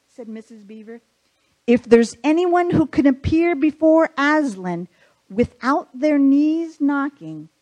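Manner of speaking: put-on reading a children's book aloud with light character voices; story narration. A narrator's reading. Pastor reading Narnia to the congregation, storytelling. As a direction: tell it like a bedtime story to the congregation.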